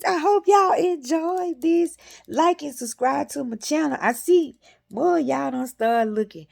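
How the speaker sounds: high, loud sign-off to viewers; upbeat outro. Real, not acted. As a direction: pump up the goodbye.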